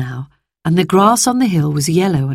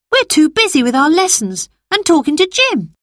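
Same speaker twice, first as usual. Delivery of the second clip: put-on young girl voice; 11 semitones higher than usual. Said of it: "replied Phyllis".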